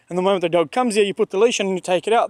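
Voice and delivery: ordinary delivery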